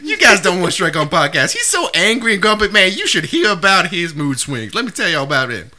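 high, chattering cartoon donkey; Donkey from Shrek. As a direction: gossip as the talkative donkey sidekick. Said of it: "Donkey from Shrek".

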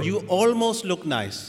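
high, slow, loud mock mum; mum's backhanded compliment. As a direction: deliver a sweet backhanded dig. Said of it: "My mum's favourite line was".

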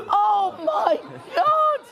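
a fan melting down in front of his idol; starstruck gasp. Retold or imitated. Fan meeting [name], overwhelmed high gasp. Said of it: "And [name] like".

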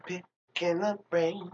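drawn-out silly chant; slow goofy voice. Pretend. Repeated silly catchphrase.

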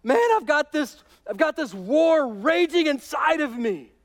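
high, loud, pleading voice of a torn Paul; voicing Paul. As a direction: play Paul wrestling with his flesh. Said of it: "he goes".

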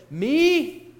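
a sudden high, loud incredulous "Me?"; Mary can't believe she's chosen; surprised disbelief. Retold or imitated. Mary, stunned at the angel's greeting, asks in loud high disbelief. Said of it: "And Mary says".

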